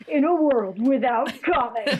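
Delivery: laughing through a trailer narrator line; mock epic trailer announcement; movie trailer voice. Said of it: "Movie trailer voice".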